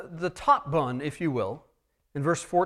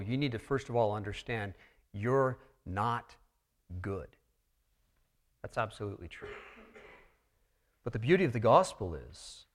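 usual speaking voice

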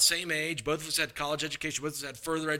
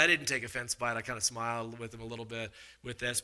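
ordinary talk

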